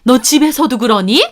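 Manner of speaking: high, loud furious scolding; shrieky outrage in an older relative's role; frothing mother-in-law. A narrator's reading. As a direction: berate the daughter-in-law at full pitch. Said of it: "어머님 거품 물더군요".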